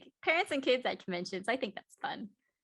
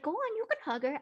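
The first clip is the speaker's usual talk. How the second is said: dad encouraging; 8 semitones higher than usual. Retold or imitated. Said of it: "he's like".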